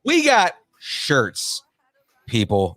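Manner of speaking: high, slow echo of the slogan; drawn-out catchphrase. Pretend. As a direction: stretch out "shirts", then drop on "people". Co-host echoes the catchphrase, stretched.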